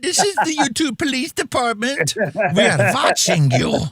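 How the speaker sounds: put-on police officer voice; mock official warning voice. Mock YouTube police announcement.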